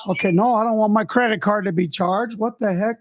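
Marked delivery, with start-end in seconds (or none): none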